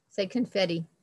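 ordinary delivery

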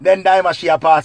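ordinary talk

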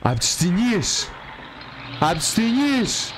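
high, drawn-out exclamation; excited call-out. Real, not acted. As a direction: stretch it out high, calling out. Calling out the film reference. (ενθουσιασμένη, τραβηγμένη αναφώνηση)

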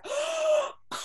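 high, sharp gasping cry; imitated shocked gasp. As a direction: gasp like you've just been slapped. Girl shocked after face smack.

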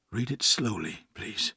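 soft, restrained request; hushed plea from a stunned man; shaken, subdued. A narrator's reading. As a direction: ask quietly, holding in the shock. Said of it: "his face pale and trembling".

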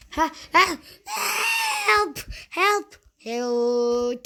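hammed-up panic as your brawler gets cornered; mock cry for help. Gamer dying in the match, crying out to viewers in mock panic.

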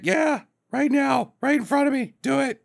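high, insistent lady impression; hammed-up fancy lady, pitched far up; Roberta. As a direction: order him about in a put-on feminine voice. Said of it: "Roberta sounds just like my lady impression".

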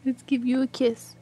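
normal talking voice

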